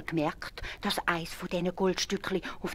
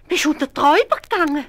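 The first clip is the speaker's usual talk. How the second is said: high, loud astonished wife; shocked housewife voice; wife's voice; 10 semitones higher, 12 dB louder than usual. A narrator's reading. Wife asking husband about the gold, high shocked voice.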